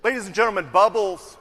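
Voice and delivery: loud showman opening; announcer call. Acted. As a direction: announce it to the room. Clip opens with announcement.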